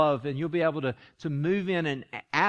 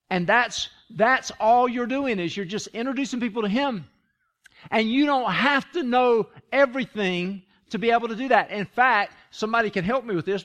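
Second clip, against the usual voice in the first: higher and louder, driving the point home; full-force preaching; 7 semitones higher, 5 dB louder than usual. Acted. Pastor urging the congregation to share Jesus, full-force preaching. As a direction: preach it out, slowing on all you're doing.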